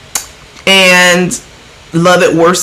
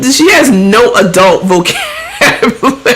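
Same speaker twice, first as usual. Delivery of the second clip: real laugh taking over the words; breaking into laughter; 5 semitones higher than usual. Real, not acted. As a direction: start higher, then crack up mid-sentence. Breaks up laughing at her impression.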